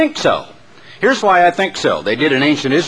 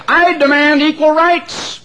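very high, slow protest; protesting goose; 13 semitones higher, slower than usual. A narrator's reading. The goose protesting to the hen, very high and slow. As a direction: squawk a demand slowly. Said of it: "protested the goose".

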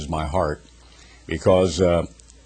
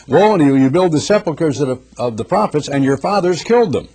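preaching scripture; 4 semitones higher than usual. Acted. Preacher proclaiming woes to the lawyers. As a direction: declaim the woes with force.

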